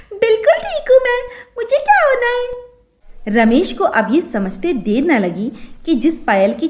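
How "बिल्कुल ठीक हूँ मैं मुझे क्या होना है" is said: put-on high babyish lisp; lisping childish voice. Bride reassuring her husband in a high lisping voice. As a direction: chirp it like a little girl. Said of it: "वो तोतली है".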